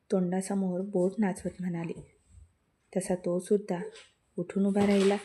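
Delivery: usual speaking voice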